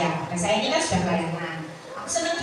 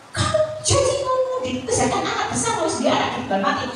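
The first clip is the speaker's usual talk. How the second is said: put-on high, whiny voice like a child; childish wailing; 14 semitones higher than usual. Grieving wife yelling at the coffin, high and childlike. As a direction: whine it like an upset little kid. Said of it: "Seakan-akan dia itu anak kecil".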